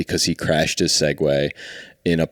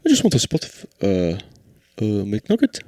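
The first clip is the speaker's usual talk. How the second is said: much higher hammed-up Irish accent; high mock Irish voice; 12 semitones higher than usual. Bandmate introduces the Irish character in a hammed-up high Irish accent. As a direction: play a cartoon Irishman, pitched way up. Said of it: "he's always like".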